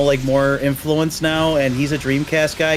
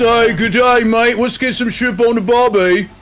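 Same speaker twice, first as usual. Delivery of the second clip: light mock Australian accent, a bit higher; 5 semitones higher than usual. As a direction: toss out a jokey Australian accent. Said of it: "did an Australian accent".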